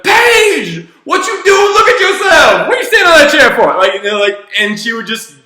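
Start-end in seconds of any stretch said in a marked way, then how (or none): 0.0-3.7 very high, loud hammed-up telling-off; mock scolding. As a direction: shout an exaggerated scolding to tease a kid out of it. Counsellor retells mocking the girl back.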